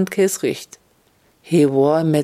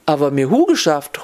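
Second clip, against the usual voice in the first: ordinary delivery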